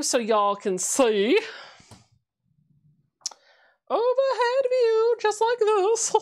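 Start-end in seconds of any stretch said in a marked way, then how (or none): 3.9-6.1 high, slow mock announcer; hammed-up high announcer voice. Narrating the camera switch for viewers, high mock announcer voice.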